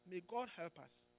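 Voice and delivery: ordinary delivery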